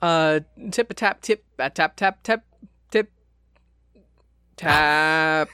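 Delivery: drum roll. Pretend. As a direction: mouth drum roll with tip tap. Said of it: "can I get a little drum roll".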